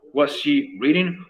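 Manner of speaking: slow, careful teacher model question; slow demo. Shown as a demonstration. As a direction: spell the question out slowly for students. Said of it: "y además le agrego entonces". (pregunta modelo lenta y clara)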